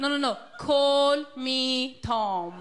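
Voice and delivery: very slow, word-by-word correction; "call me Tom" stretched out word by word. Retold or imitated. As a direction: spell it out for a slow listener. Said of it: "And he went".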